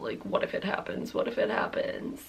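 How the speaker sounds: low hushed dread, barely voiced; anxious whisper. Waiting to feel the drink, a frightened whisper. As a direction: whisper the fear as it builds.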